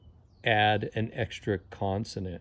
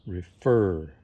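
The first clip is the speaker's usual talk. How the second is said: "refer" with the second syllable "fer" louder; stress on second syllable; 3 semitones lower, 5 dB louder than usual. Shown as a demonstration. Teacher showing which sound is stressed, "refer" stressed on "fer". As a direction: lean hard on the "fer" of "refer". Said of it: "the second sound in the word is stressed".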